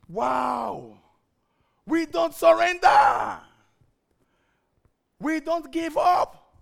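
shouted slow and loud, defiant; scream. Retold or imitated. Pastor screaming at a household member who would quit. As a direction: roar it out, refusing to give up. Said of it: "So I screamed".

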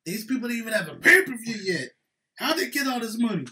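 loud, high indignant outburst; mock outraged wrestling fan; angry fan. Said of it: "Impact's fans are so angry".

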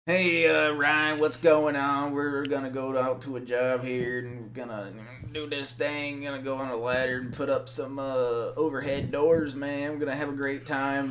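put-on lightly done North Carolina accent; imitating North Carolina accent. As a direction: mimic a cheerful North Carolina coworker. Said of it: "the heaviest North Carolina accent".

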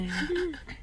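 short high laugh that sounds like sobbing; crying-like laugh. Shown as a demonstration. Demonstrating her laugh to friends, a sob-like laugh. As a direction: show how your laugh sounds like crying. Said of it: "what I sound like when I cry".

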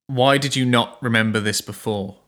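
usual speaking voice

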